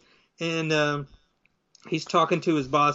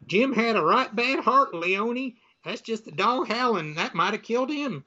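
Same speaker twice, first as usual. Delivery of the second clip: higher-pitched folksy put-on voice; country character voice; 6 semitones higher than usual. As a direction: play a rural neighbor, pitched up. Voicing rural couple in the story.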